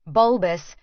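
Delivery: ordinary delivery, nothing marked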